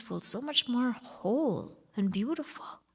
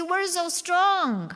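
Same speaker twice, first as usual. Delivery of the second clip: mock tough; 6 semitones higher, 3 dB louder, slower than usual. Mocking loud strength display.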